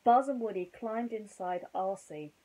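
plain reading voice

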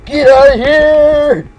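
slow, high, loud yell; drawn-out shout. Acted. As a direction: stretch it out, yelling him off. Yelling at opponent in game.

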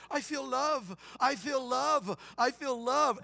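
high, mock-tearful accented cry; imitated weeping. New convert arriving at church, imitated weeping. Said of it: "he was just weeping".

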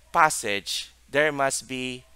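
ordinary talk

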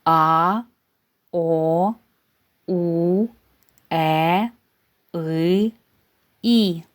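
each vowel а, о, у, е, и, і sung out slowly and cleanly on its own; isolated vowels а, о, у, е, и, і pronounced crisply, one by one; clear vowel demo. Shown as a demonstration. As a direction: shape every vowel а, о, у, е, и, і clearly for children. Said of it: "Ми вже з вами вивчили шість голосних звуків".

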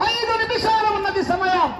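high-pitched announcement; sustained high announcing voice; announcer's time call. Real, not acted.

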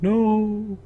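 high, hammed-up yelp of "No!"; a lightly voiced panicked guard; mock enemy cry. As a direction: squeal it like a startled cartoon guard. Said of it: "He's like".

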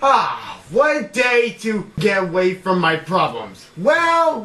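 normal talking voice

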